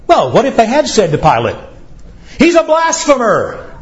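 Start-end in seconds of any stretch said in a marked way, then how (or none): 2.4-3.7 mock accuser. Preacher voicing accusers to Pilate.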